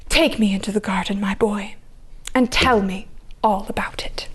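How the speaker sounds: low, hushed whisper of a moved father. Acted. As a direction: breathe it, too moved to speak aloud. Moved father speaks to son.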